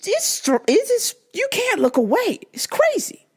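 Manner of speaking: normal talking voice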